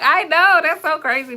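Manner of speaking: high, loud, amused admission; excited agreement. Retold or imitated. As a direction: laugh it off in a high burst. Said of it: "And I'm like".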